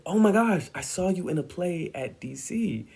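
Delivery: high, excited fan gushing; a touch of starstruck fan. Retold or imitated. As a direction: gush at the actress you admire. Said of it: "and I was like".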